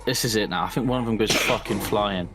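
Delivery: ordinary talk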